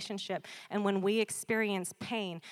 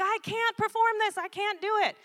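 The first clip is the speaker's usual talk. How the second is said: high, louder, exasperated Paul; frustrated Paul; 12 semitones higher, 6 dB louder than usual. Retold or imitated. Preacher voicing Paul in Romans seven, high and exasperated. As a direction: cry out like a man at war with himself. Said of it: "He's like".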